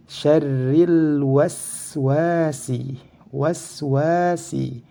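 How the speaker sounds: very slow, chanted "Syarril waswasi" with long wa; recited "waswasi", measured and drawn out; two-beat long vowel. Shown as a demonstration. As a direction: stretch the second wa of "waswasi". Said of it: "harus dibaca dua harokat".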